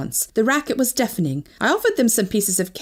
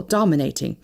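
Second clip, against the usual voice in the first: ordinary delivery, nothing marked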